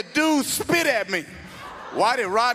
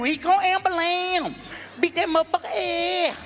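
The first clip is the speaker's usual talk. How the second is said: Rodney's voice; 7 semitones higher than usual. Retold or imitated.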